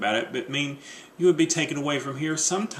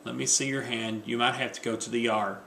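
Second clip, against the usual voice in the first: softer, caring check of the hand; soft concern; 3 semitones lower, 7 dB quieter than usual. Acted. Wife checking husband's cut hand, softly concerned. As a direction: hush down and look at the wound.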